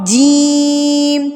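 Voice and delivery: جیم drawn out very slowly, a clear j, not ch; clear jeem. Shown as a demonstration. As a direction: hold جیم long and keep it apart from چ. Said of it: "جیم کی آواز نا چے سے نہ ملتی". (جیم صاف، چے سے الگ)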